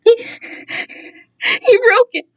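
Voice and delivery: high, very slow tearful sob; childlike crying, pitched high. Acted. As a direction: sob the words out brokenly. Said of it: "Kai cried".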